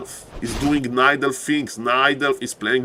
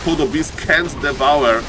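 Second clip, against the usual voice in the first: ordinary talk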